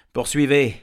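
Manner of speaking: high, loud order; commanding call. Acted. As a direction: press the dragon to continue. Farmer orders the dragon on. (ordre lancé fort, voix haute)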